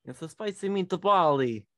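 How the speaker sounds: cartoon Italian accent, slow and high; mock Italian accent. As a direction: ham up an Italian chef. Joke Italian voice sign-off.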